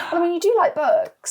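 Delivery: laughing, high-pitched teasing reply; laughing tease. Real, not acted.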